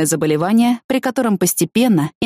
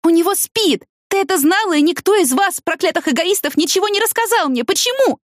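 высоко, с возмущением; обвиняй подругу на высоком крике, всё выше к последнему вопросу; гневная тирада; 11 semitones higher than usual. A narrator's reading. Продолжает кричать на подругу. (негодующе)

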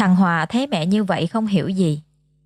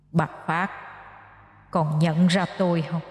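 vengeful ghost, much softer and eerie; 6 dB quieter than usual. Acted. Ghost of the first wife menacing Mrs Phát, softly. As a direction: breathe it like a wronged spirit.

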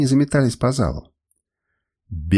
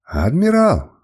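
high, louder call of "Admiral"; distant crowd cry; 6 semitones higher, 4 dB louder, slower than usual. A narrator's reading. Followers cry after their departing admiral, calling out. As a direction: call out after him, voice raised. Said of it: "доносились до меня чьи-то крики".

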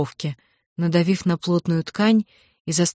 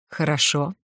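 understated, pleased consent; satisfied; 2 semitones lower, 3 dB quieter than usual. A narrator's reading. Captor answering the girl who gave in, satisfied. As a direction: accept it with quiet satisfaction. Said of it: "довольно прозвучало в ответ".

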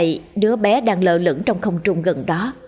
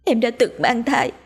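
higher, anxious confessing wife; wife's confession; 5 semitones higher than usual. Acted. Wife confessing her secret abortion to her husband, anxious. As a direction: confess it fearfully, voice raised.

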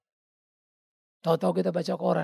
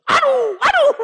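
high, loud alarmed cry of a nervous passenger; frightened wife yelping in the car; panicked gasp; 11 dB louder, slower than usual. Retold or imitated. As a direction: squeal as if a crash is coming.

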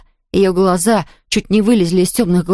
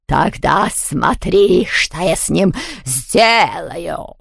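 slow, drawn-out threat; spiteful ghost; much slower than usual. A narrator's reading. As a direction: breathe out the threat word by word. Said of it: "злобно выдохнул Яспера".

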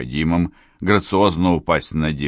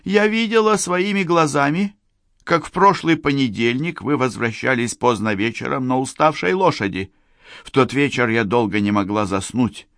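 higher, hurt woman recounting; Sofia's voice; 7 semitones higher than usual. Acted. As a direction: tell him what she saw, aggrieved. Sofia's voice held.

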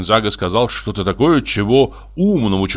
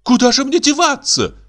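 very high, dismayed cry; high-pitched horrified question; aghast gasp. Acted. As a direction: gasp it out in shock. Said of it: "ахнула Каролина".

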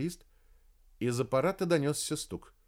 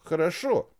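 louder hurried хорошо; hurried agreement; 6 semitones higher, 5 dB louder than usual. Acted. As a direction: blurt хорошо quickly to give in. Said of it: "торопливо закивал ботаник". (торопливо, громче)

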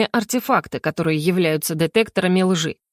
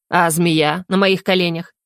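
ordinary delivery

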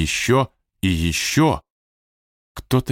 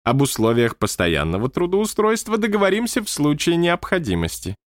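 lightly raised, stately manager; high manager voice; 5 semitones higher than usual. Acted. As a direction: speak as the odd high-voiced boss. Manager character voice. (высокий голос руководителя)

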